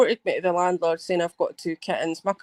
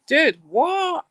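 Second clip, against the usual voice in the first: incredulous; 7 semitones higher, 3 dB louder than usual. Retold or imitated. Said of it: "it's like".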